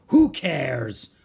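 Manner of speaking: loud, dismissive roar; pirate roar. A narrator's reading. Captain brushing off a child's rebuke, roaring. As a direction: roar it off scornfully. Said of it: "roared Captain Bones".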